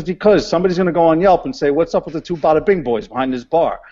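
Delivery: high, fast mock Brooklyn wife; a lightly voiced wife from Brooklyn, fast and high; imitating his Brooklyn wife. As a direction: rattle off the warning like a Brooklyn Italian wife. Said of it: "my wife is an Italian woman from Brooklyn".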